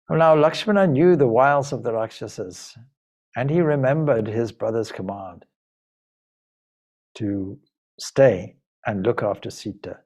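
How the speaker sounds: plain reading voice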